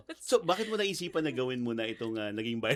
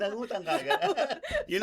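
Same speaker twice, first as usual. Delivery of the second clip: laughing; 10 semitones higher, 5 dB louder than usual. Real, not acted. Host teasing guest, laughing.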